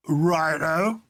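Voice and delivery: a bright cockney righto, just hinted; a hint of Cockney accent. A narrator's reading. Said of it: "a clear, commercial, and somewhat cockney voice".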